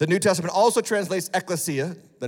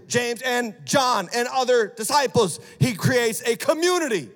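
preaching at full force; 5 semitones higher than usual. Acted. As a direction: preach it to the congregation with fire.

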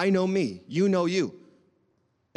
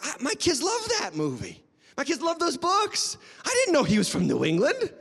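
higher-pitched mock delight; acted excited discovery; 7 semitones higher than usual. Reader discovering a favourite author is local, acted excitement. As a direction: play up the happy surprise.

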